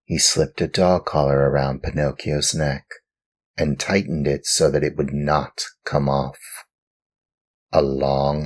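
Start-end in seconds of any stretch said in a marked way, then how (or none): none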